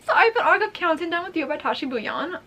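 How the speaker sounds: high, laughing announcement; giddy excitement. Real, not acted. Vlogger showing off the advance copy, giddy and high. Announcing the book while overwhelmed.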